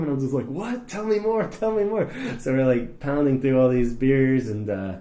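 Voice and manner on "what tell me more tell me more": high-pitched, eager begging for more; mock-giddy excitement; eager fan. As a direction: play the starstruck fan, voice jumping up. Retells eager reaction to new friends.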